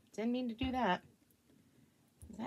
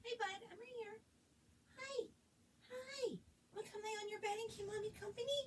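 soft pet talk; 9 semitones higher, 15 dB quieter, faster than usual. Real, not acted. Mom softly coaxing her dog to keep her company. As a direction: coax the pup over gently.

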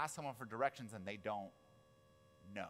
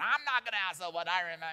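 loud, high, indignant outburst; mock-irate driver ranting; stubborn refusal; 6 semitones higher, 7 dB louder, faster than usual. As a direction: blow up at the nagging passenger. Acted refusal in car quarrel.

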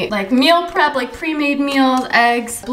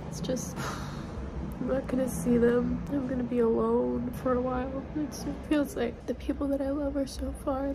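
soft, withdrawn, near tears; sad; 12 dB quieter than usual. Real, not acted. Vlogger admits to viewers how isolated she feels abroad, softly and sadly. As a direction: let the loneliness pull the voice down to almost nothing. Confessing loneliness after old photos.

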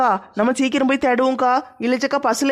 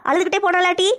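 higher-pitched, dismayed questioning; dismayed surprise; 5 semitones higher than usual. Acted. Grandmother hears that the mother left crying, dismayed surprise. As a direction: ask in raised-pitch dismay.

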